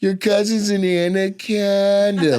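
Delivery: put-on high, slow Valley girl quote. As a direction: stretch out anaconda, Valley girl style. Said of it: "to this day, she goes".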